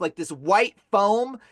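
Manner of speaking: ordinary talk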